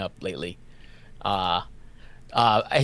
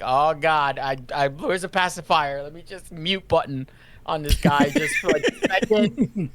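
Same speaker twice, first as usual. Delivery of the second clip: mildly frantic, higher-pitched plea; flustered; 4 semitones higher than usual. Retold or imitated. Dad hunting for the pacifier, flustered. Acting out panic as baby winds up.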